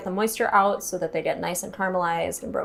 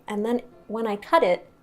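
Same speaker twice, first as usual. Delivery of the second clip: ordinary talk, nothing marked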